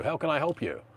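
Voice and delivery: normal talking voice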